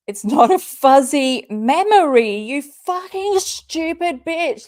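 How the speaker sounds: furious outburst. Real, not acted. Reacting to Katie's tweet, she bursts out in fury. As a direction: let real rage spill out. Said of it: "I feel like I'm so angry".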